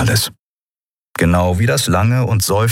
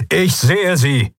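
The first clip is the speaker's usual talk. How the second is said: slow, high barked reply; tired admiral barking at his officer; 9 semitones higher, slower than usual. Acted. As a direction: snap back curtly. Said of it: "bellte Telios".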